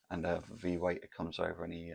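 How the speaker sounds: usual speaking voice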